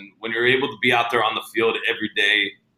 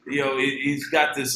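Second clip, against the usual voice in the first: ordinary delivery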